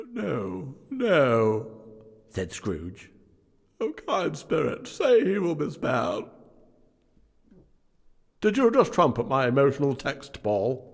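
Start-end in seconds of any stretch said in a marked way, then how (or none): 0.1-1.7 high, rising loud protest; anguished old miser. Acted. Scrooge pleading with ghost. 3.8-6.3 high, rising loud protest; anguished old miser. Acted. Scrooge begging the spirit. 8.4-10.9 indignant. Real, not acted. Reader scolds a fart.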